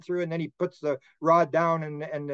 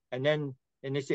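usual speaking voice

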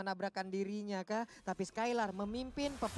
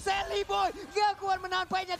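high, loud hype call; fighting game announcer; 7 semitones higher, 4 dB louder than usual. Real, not acted. Caster hype.

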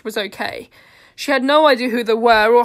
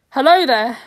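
blunt; 8 dB louder than usual. A narrator's reading. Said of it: "they said bluntly".

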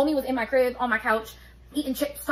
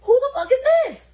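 high, loud retold outrage; shocked shout at a stranger; furious outburst; 12 semitones higher, 8 dB louder than usual. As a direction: explode at the stranger in the photo. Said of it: "And I'm just here like".

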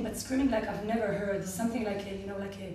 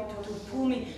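usual speaking voice

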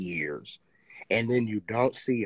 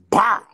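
loud, high-pitched "bow"; explosive impact noise; 11 semitones higher, 12 dB louder than usual. Retold or imitated. Retelling how Crims picked someone up, explosive impact noise. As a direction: punch out a sudden impact sound.

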